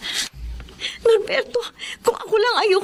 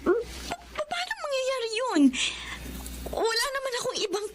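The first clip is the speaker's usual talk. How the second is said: higher, much softer, tearful disbelief; wife stunned by her diagnosis, softly sobbing; shocked crying; 5 semitones higher, 12 dB quieter than usual. Acted. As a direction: break down quietly in shock. Patient hears shocking diagnosis.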